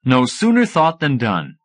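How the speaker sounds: usual speaking voice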